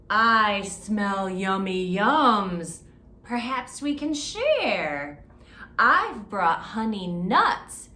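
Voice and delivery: loud slow friendly badger. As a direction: sniff out the treats. Badger speaks.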